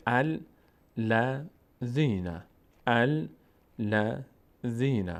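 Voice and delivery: الذین in slow separate syllables, al-la-dhina; opening fatha. Shown as a demonstration. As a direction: begin الذین with a clear "a", then spell it out slowly.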